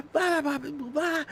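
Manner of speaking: high, panicked babbling; mock meltdown into made-up words; hysterical gibberish. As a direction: wail nonsense syllables while losing it. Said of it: "I'm making up my own language".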